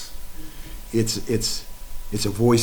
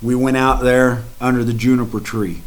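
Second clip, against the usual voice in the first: ordinary delivery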